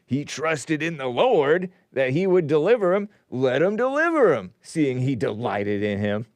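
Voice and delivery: jeering scripture quote, swooping high mid-phrase; mocking. Onlookers scorning the psalmist, mocking. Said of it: "Kind of jealousy, mocking".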